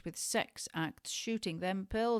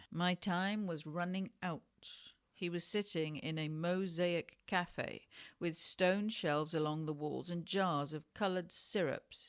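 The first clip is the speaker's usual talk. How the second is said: plain reading voice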